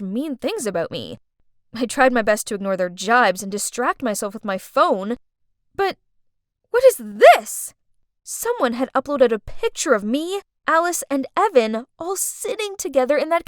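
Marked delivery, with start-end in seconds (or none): 5.7-7.7 slow, loud, shocked question. Acted. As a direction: stretch it out in disbelief. Shock at the photo.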